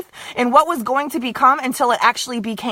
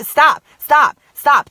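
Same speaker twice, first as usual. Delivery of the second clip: loud, firm stop said over and over; repeated loud stop; 2 semitones higher, 6 dB louder, much slower than usual. Shown as a demonstration. Coach demonstrates a self-command for her teammate, repeated loud stops. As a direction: bark stop at your own negative thoughts. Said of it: "literally do it as many".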